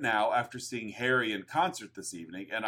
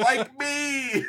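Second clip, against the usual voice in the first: very high, emphatic mock cry; mock plaintive cry; 12 semitones higher than usual. Co-host imitates the review's sudden turn, high mock cry. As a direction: yelp it as a sudden plea. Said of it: "immediately follows up".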